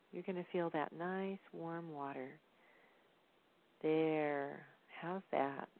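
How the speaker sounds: put-on talking to baby. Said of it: "things you might want to tell your baby".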